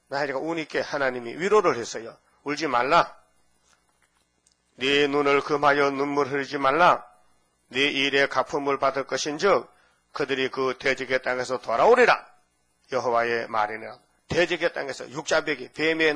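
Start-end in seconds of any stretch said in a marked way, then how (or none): 2.4-14.0 louder solemn reading of God's words; scripture reading. Acted. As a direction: proclaim it with comfort. Reads God's comfort. (힘 있는 성경 낭독)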